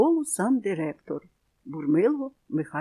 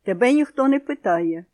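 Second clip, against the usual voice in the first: louder, sharp stern rebuke; stern father; 5 semitones higher, 6 dB louder than usual. A narrator's reading. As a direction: cut the child off sternly. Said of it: "суворо сказав тато Заєць". (суворий батько, голосніше)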